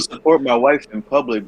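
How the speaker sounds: ordinary delivery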